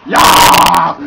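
loud victory yell; triumphant shout. Real, not acted. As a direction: bellow it after winning.

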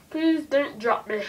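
put-on slow, loud British-accented plea. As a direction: beg nervously in a British accent. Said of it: "British accent when I'm, when I'm nervous".

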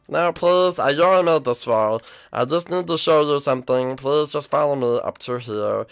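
ordinary talk, nothing marked

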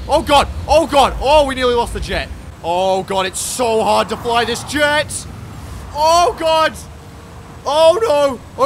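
loud, high panicked cries; panicked yelling. Real, not acted. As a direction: shout as the jet nearly goes down. Nearly crashing the jet.

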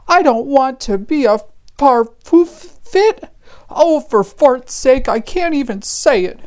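high-pitched exasperated little character voice; exasperated fart character. Acted. A fart objecting to the new fancy title, exasperated. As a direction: stumble over the word, then give up in a high voice.

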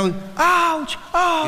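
high, falling mock cries of pain; imitated yelps. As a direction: yelp like a boy being smacked. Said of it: "Și prietenul meu".

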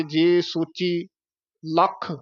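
slow ਜੇ ਸੋਚੀ, then a halt before ਲੱਖ; slight pause. Shown as a demonstration. Teacher showing where to pause, measured pausing. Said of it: "ਇੱਥੇ ਥੋੜ੍ਹਾ ਠਹਿਰਾ ਦੇ ਕੇ".

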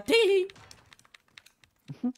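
high-pitched tee hee giggle; a girlish tee hee to close the joke; mock giggle. Giggle after the fortune teller joke.

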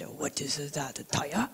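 hushed, low and gentle question; soft whispered teacher. Retold or imitated. Rinpoche gently asks his student, hushed and kind. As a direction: ask it barely above a whisper, kindly.

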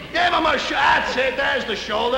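high loud burst of triumph; excited outburst. Acted. Scout thrilled with the shoulder padding, shouting. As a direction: shout it when it finally looks right.

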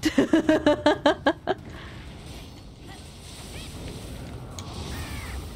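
long, high burst of laughter; laughing. Real, not acted. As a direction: crack up in startled amusement. Cracks up at the eyeballs.

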